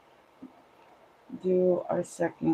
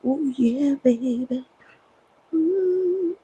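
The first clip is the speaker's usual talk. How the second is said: hyped up and higher; too much caffeine; 8 semitones higher, 5 dB louder, slower than usual. Real, not acted. The coffee has kicked in.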